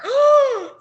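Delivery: loud, high shocked gasp; sharp amazed gasp. Real, not acted. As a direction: suck in air in disbelief. Shocked at serial thief.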